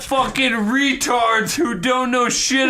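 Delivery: high-pitched comic tirade; mock rant. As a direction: push it up high like an exasperated shout. Comic rant, calling out loudly.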